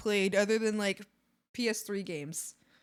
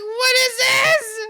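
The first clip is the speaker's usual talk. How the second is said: high, loud, slow squealing cat voice; voicing the cat; 12 semitones higher, 14 dB louder, much slower than usual. Streamer voicing the cat meeting the frog, squealing. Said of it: "The cat's like".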